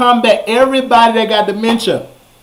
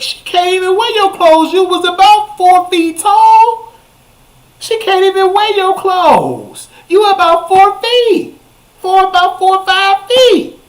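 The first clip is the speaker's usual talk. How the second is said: indignant rant; 11 semitones higher than usual. Retold or imitated. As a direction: scold her in disbelief, way up high. Angry rant at dead woman.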